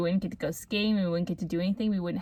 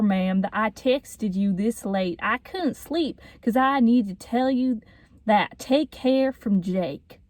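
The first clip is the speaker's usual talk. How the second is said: slow, deliberate Southern drawl; Jake's Southern accent; 3 semitones higher than usual. Acted. Reading a boy's text to friend's mom, slow Southern accent. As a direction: read it out in a put-on Southern accent. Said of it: "I could read it and do a Southern accent".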